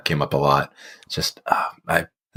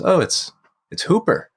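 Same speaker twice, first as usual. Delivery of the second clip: a light imitation of the lady, pitched higher; light higher lady voice, matter-of-fact; lady answering; 11 semitones higher than usual. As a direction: sweetly tell them the dog's name, lightly voiced. And the lady says.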